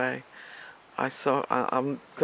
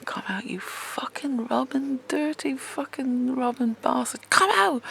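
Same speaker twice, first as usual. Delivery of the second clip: retold screaming; 8 semitones higher than usual. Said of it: "I was screaming".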